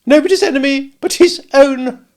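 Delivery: a shrill-pitched exclaim of false pity; Camilla's abrupt voice. A narrator's reading. Narrator voicing Camilla pitying someone, abrupt and high. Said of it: "with an abruptness of manner quite like my sister's".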